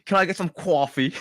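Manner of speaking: playful Canadian order, "coffee" as kwah-fee; Canadian accent. Shown as a demonstration. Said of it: "what's the Canadian way".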